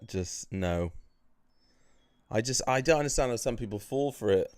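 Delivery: ordinary talk, nothing marked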